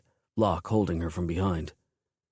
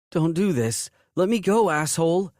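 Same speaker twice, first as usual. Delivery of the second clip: higher and louder, struggling; frightened cry; 10 semitones higher, 4 dB louder than usual. A narrator's reading. Woman grabbed in a crowd, crying out in fear. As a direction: cry out while being dragged. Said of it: "a woman's voice rang out".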